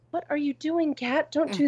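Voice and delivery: high, soft voice for the cat; talking to the cat. Real, not acted. To the cat pawing at the mic arm, high soft scolding. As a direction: coo a telling-off up high to the pet.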